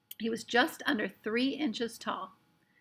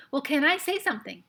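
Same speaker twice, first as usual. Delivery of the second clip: higher, louder impatient question; urgent, impatient; 6 semitones higher, 5 dB louder than usual. A narrator's reading. Boy pressing the silent Indian, urgent and impatient. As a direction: push it out, fed up with waiting. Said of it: "said Omri urgent, impatiently".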